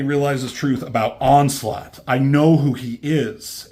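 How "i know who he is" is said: urgent realization. Retold or imitated. Xavier's sudden realization.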